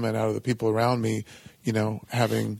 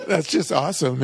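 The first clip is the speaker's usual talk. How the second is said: laughing; 5 semitones higher, slower than usual. Retold or imitated. Laughing at a harsh retort.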